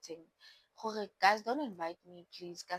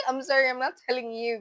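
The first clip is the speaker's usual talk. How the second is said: laughing through a loud apology; real laugh while apologising; 4 semitones higher, 7 dB louder, faster than usual. Real, not acted. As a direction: let the laugh spill into the sorry. Laughs at her own rant.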